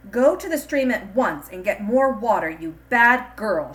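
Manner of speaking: loud, harsh stepmother order; angry shout. A narrator's reading. Stepmother orders Chinye to the stream, shouting angrily. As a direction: bark the order at the girl. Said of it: "she shouted angrily".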